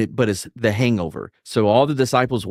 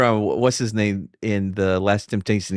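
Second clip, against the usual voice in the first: ordinary delivery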